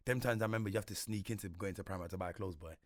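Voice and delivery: ordinary talk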